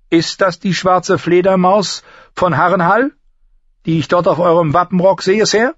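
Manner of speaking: high, clear-voiced lady addressing a knight; composed noble lady. Lady asks an older man about his crest, high and formal.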